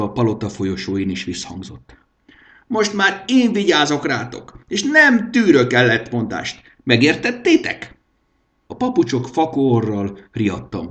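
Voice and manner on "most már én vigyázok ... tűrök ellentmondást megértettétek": high, loud domineering king; a high-pitched petty tyrant laying down the law; bossy king. A narrator's reading. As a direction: lord it over the servants. King voiced in story.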